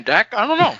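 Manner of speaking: chewing while talking; words round a bite of pizza; mouth full. Real, not acted. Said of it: "I got pizza in my mouth".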